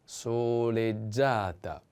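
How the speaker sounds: "soleggiata" drawn out syllable by syllable; slow model pronunciation. Shown as a demonstration. Teacher models the feminine form, slow "soleggiata". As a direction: stretch each vowel of "soleggiata" slowly.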